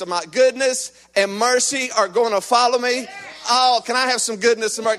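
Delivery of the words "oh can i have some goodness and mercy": ordinary talk, nothing marked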